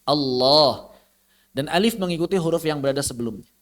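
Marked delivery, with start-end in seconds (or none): 0.0-0.9 "Allah" with a heavy double l and o-like long a; thick lam. Shown as a demonstration. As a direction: draw out a thick, dark "Allah". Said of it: "tampak dengan jelas ketebalan huruf".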